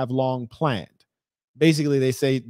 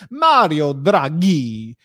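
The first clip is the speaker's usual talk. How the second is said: very slow, drawn-out Italian name; mock Italian accent; much slower than usual. Streamer announces the Italian Prime Minister, exaggerated Italian accent. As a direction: roll it out with a hammed-up Italian flourish.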